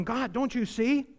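high, pleading cry to God; pleading prophet. Retold or imitated. Preacher voicing Habakkuk pleading with God, high. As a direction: voice Habakkuk begging God to act. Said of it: "Habakkuk's going".

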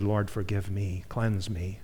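soft, humble plea to God; hushed prayer. Retold or imitated. Father on his knees after disciplining his child, praying softly. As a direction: murmur it like a quiet confession.

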